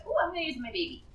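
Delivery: normal talking voice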